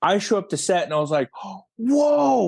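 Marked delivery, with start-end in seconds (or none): 1.8-2.5 high, wide-open wowed exclamation; retold awe. Director arriving on set to a huge trailer, awed. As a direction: replay being bowled over. Retelling first sight of new trailer.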